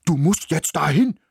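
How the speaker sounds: dog Ismir urges the mouse on, barked; barked order. A narrator's reading. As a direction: bark it like an eager dog. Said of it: "bellte er".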